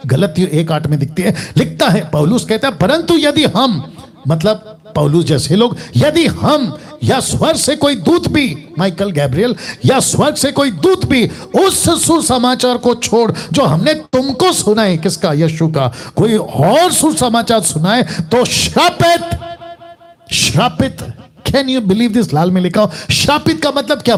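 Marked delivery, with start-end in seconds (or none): 2.8-3.9 high, slow, emphatic scripture quote; पौलुस का गुस्सा. Acted. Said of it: "गुस्सा". 6.0-8.7 high, slow, emphatic scripture quote; पौलुस का गुस्सा. Acted. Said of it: "गुस्सा". 9.9-15.0 high, slow, emphatic scripture quote; पौलुस का गुस्सा. Acted. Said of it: "गुस्सा". 16.2-21.0 slow, high-pitched curse; पौलुस का गुस्सा. Acted. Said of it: "आपको इतना गुस्सा क्यों आ रहा है".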